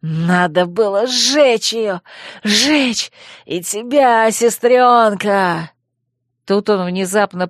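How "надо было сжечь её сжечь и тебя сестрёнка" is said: madman. Acted. Madman threatening his sister.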